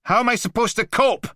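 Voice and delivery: very high, loud anguished cry; tearful shout. Acted. Blakey screaming at the Mantis near tears, anguished. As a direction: cry it out in despair. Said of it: "Tears sparked in his eyes".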